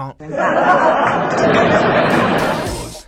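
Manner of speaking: 憋不住地低声笑起来; 被那句恭维逗得直乐; 轻声大笑. Real, not acted. 笑谈来电者的恭维. (忍不住笑出声)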